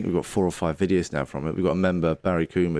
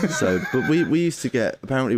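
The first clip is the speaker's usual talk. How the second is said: chuckling through the words; laughing while talking; 7 semitones higher than usual. Real, not acted. Laughing while telling story.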